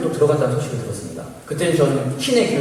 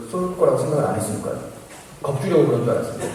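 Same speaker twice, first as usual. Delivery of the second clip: ordinary delivery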